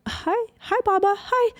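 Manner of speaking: put-on high small child voice, hesitant and halting; imitating shy little son. Mom retelling her son greeting grandpa, high hesitant kid voice. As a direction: mimic a four-year-old who freezes up on the phone.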